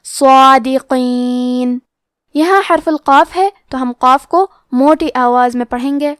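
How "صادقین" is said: صادقین recited slowly with a heavy صاد and a heavy, long alif; heavy sad. Shown as a demonstration. Tajweed teacher showing heavy letters, slow recitation. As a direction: chant صادقین full and heavy. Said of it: "مد الف کو بھی بھاری آواز میں ادا کرنا".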